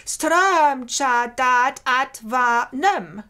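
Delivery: slow, every syllable of "Strömcha dat at va nem" spaced out; syllable-counted reading. Shown as a demonstration. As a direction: count the seven syllables aloud slowly. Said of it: "to get seven syllables".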